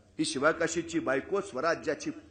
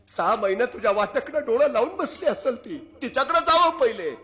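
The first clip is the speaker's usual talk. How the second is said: impassioned mother; 7 semitones higher, 8 dB louder than usual. Acted. Mother scolds king lovingly.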